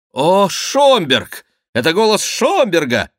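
high, loud cry of recognition; excited shout. A narrator's reading. Three favourites hear their friend's voice, shouting excitedly. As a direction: stretch the name, then shout the discovery. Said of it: "закричали трое миньонов".

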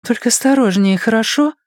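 high female character asking; young woman's voice. A narrator's reading. Ann cautions Blaise, high girlish voice. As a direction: lift it high into the girl's voice.

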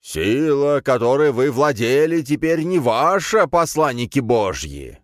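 high, drawn-out proclamation; cult leader. A narrator's reading. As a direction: preach triumphantly at the captives. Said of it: "обратился большой брат к ней". (торжественная, протяжная речь главаря секты)

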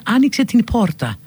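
αργό «την πόρτα» με χωριστά ν και π; χώρισε το «την» από το «πόρτα», με άηχο π; λάθος προφορά άλλων. Retold or imitated. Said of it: "μερικούς που λένε". («την πόρτα» με σκληρό π)

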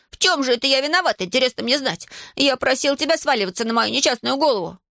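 high-pitched aggrieved protest; indignant. A narrator's reading. Иван demands Аделаида explain his guilt, indignant.

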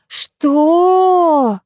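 very high, stretched astonished what; drawn-out falsetto squeal; falsetto shriek. A narrator's reading. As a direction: slide up into falsetto disbelief. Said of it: "перешёл на фальцет".

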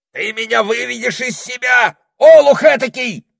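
loud high angry shout. A narrator's reading. Parrot shouting at the foolish sentry. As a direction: yell in exasperation. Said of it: "закричал попугай".

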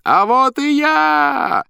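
very high, slow, loud stage cry; loud clown shout. A narrator's reading. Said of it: "громко крикнул хозяин". (громкий клоунский выкрик)

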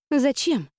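put-on bewildered girl's query; puzzled. A narrator's reading. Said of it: "озадачилась Астелла".

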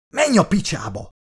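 high-pitched, sharp snap back; snapped retort. A narrator's reading. Margie tells Dan to get lost, snapping back. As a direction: fire it straight back at him. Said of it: "vágta rá".

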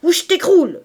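high, loud warning cry; fierce, high-pitched shout; screamed threat. A narrator's reading. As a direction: scream at them to keep back. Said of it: "cria-t-elle".

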